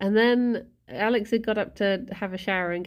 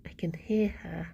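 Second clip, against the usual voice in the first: whispering; 4 semitones lower, 7 dB quieter than usual. Real, not acted. Quiet so pet isn't disturbed.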